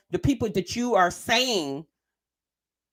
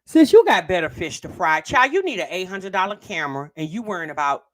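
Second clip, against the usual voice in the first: ordinary delivery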